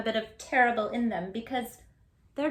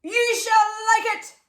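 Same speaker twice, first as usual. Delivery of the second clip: mock proclamation; 11 semitones higher, 7 dB louder than usual. Villagers' mock proclamation ending.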